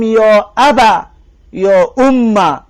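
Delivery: «یا ابا» slow, with the final "a" short; drawn-out «یا اما», final vowel clipped short; alif dropped demo. Shown as a demonstration. Teacher to students, slow demo of the dropped alif. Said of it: "الف حذف کنیم، بگیم".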